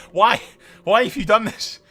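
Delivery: usual speaking voice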